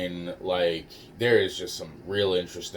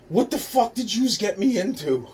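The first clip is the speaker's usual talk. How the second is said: high-pitched indignant outburst; flipping out; 12 semitones higher than usual. Retold or imitated. Little girl confronting those who involved her, flipping out. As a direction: imitate a girl freaking out, pitch way up. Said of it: "she really fucking flipped out".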